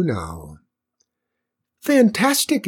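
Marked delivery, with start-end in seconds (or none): 1.8-2.7 very high, louder flower squeal of delight; excited little flower, pitch soaring; delighted exclamation. A narrator's reading. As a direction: exclaim in a tiny thrilled voice. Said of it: "it exclaimed".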